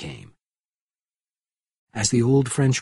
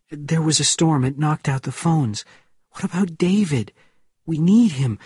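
anxious urgency; 5 semitones higher than usual. A narrator's reading. Anxious phone call after danger.

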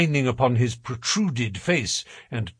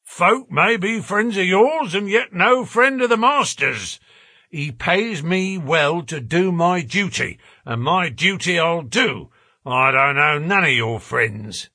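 raised, louder rough-spoken refusal; inexorable porter; 6 semitones higher, 4 dB louder than usual. A narrator's reading. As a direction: lay down your duty, unbudging. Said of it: "said the porter inexorably".

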